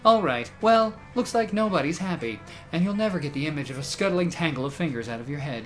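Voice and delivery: normal talking voice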